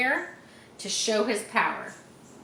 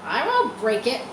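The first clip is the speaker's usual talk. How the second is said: a louder, higher rat snarl; 4 semitones higher, 7 dB louder than usual. Acted. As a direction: snarl it back at the goose. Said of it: "snarled Templeton".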